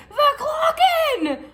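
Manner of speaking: very high, drawn-out mock shriek; retold screaming. Retelling how he woke her up at night, mock screaming. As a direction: wail it like a hammed-up night scream.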